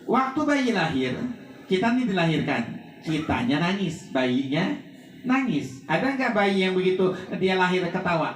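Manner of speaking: fervent preaching. Acted. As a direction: drive the warning home to the mourners.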